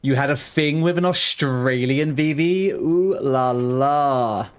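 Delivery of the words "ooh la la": slow, drawn-out teasing ooh la la. Pretend. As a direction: stretch it out mockingly, like a playful tease. Teasing about a fling.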